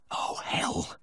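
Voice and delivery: hushed, whispered curse; dismayed whisper. A narrator's reading. Vet tangled in placenta, cursing to himself in a whisper. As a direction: whisper it as a private oath. Vet's dismayed thought during surgery.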